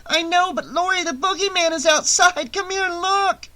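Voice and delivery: put-on high child's voice, urgent; frightened little boy. A narrator's reading. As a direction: plead with her to come look. Tommy sees the boogeyman.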